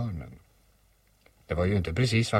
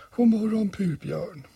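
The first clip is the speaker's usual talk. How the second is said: high, dismal character voice; gloomy donkey; 13 semitones higher than usual. Acted. Old donkey returns the bear's greeting, gloomy. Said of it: "sa Ior dystert".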